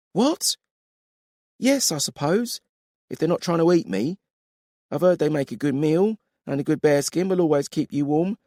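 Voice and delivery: brisk no-nonsense woman warrior voice; curt female soldier, unimpressed; stern woman warrior. Acted. Coralyn voiced.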